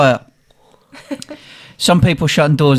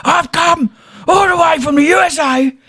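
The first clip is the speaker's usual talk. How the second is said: high, wailing mock lament from a jilted tourist; crying American tourist; 13 semitones higher than usual. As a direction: ham up a sobbing guy who got turned down. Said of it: "This guy crying in the middle of a bridge".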